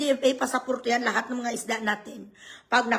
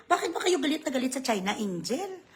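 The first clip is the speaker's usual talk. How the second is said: ordinary talk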